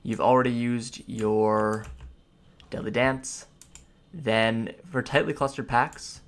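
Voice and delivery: ordinary delivery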